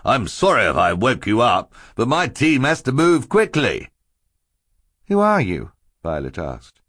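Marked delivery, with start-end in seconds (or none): 0.0-3.9 chubby man's raised, booming apology. A narrator's reading. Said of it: "booming voice". 5.1-5.7 put-on high child's voice; young girl's question. A narrator's reading. Startled girl questions a stranger.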